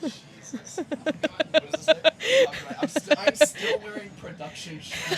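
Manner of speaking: laughing. Real, not acted. Laughing while changing shirt.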